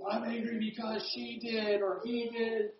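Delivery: put-on high, soft, drawn-out kid's whine; mock child's complaint, slow and high; complaining child. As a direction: play a sulky kid blaming a sibling. Acts upset child blaming others.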